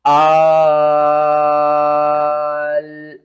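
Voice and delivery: آل drawn out very long; long madd. Shown as a demonstration. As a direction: stretch آل to five alifs. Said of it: "ہجے کس طرح کریں گے؟".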